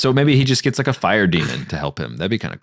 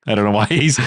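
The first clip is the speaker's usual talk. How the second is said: ordinary talk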